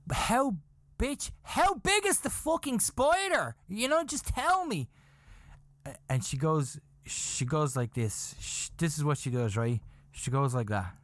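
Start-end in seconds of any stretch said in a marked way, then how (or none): 0.1-4.9 high-pitched, frantic pleading; hammed-up exasperation, voice way up; retold exasperated demand. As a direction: demand an answer in a comic high-pitched panic. Retells his exasperated demand to wife.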